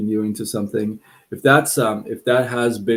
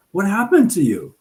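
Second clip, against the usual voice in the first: fox; 9 semitones higher, 4 dB louder than usual. Acted. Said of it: "finds the donkey and says".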